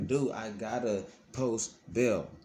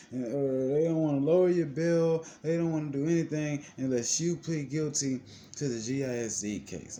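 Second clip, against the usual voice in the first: mocking the lawyer; 5 semitones higher than usual. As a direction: sneer it out like a dismissive lawyer.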